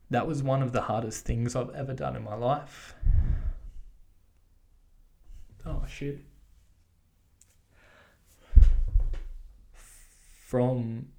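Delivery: pushing the words out while crying; emotional, teary. Real, not acted. Runner confessing his hardest day, choked up. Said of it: "I just got a bit emotional there".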